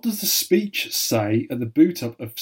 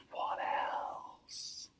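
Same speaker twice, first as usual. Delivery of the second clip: barely audible, spaced-out whisper; suspense whisper; 21 dB quieter than usual. Pretend. Quizmaster prodding for more answer, suspense whisper. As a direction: whisper it slowly for mock tension. Host prompts with mock suspense.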